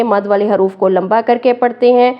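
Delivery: ordinary delivery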